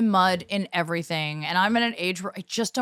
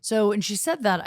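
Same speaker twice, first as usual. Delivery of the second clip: usual speaking voice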